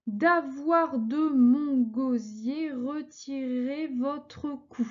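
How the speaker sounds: slow, syllable by syllable verse reading; scanning syllables slowly. Shown as a demonstration. Teacher scanning a verse for her class, slow and measured. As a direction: count out each syllable of the line as you read. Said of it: "découpé les syllabes".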